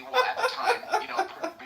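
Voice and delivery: high-pitched laugh breaking through words. Real, not acted. Laughing mid-sentence.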